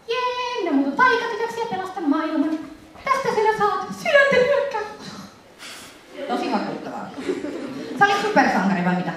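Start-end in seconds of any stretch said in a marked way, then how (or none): 0.1-4.8 put-on high, sing-song magical-girl transformation voice; mock magical girl. Presenter parodying a magical girl's transformation speech, high mock-heroine voice. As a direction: play a cutesy magical girl, pitched way up. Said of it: "taikatyttö muodonmuutokset".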